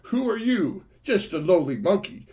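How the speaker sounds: high, loud scornful demon taunt; enraged demons. Acted. Demons taunting Monkey, loud scornful rage. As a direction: sneer down at the lowly monkey. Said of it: "the demons became enraged".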